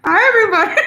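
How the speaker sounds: high, loud hello through real laughter; laughing greeting. Real, not acted. Streamer greeting viewers while laughing hard. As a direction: greet them while cracking up. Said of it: "I'm laughing so hard".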